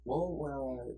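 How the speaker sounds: soft, monotone teacher imitation; soothing teacher. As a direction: start gently, like a soothing teacher. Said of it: "he has the most soothing voice".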